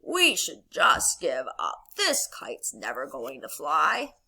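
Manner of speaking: low, slow, discouraged toad; Toad character voice. Acted. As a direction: drag it out in defeat. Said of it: "Toad goes back to Frog and says".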